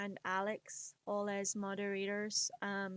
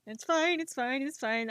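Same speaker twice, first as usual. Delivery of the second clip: mock flustered reassurance; 3 semitones higher, 5 dB louder than usual. Acted flustered self-reassurance about accent.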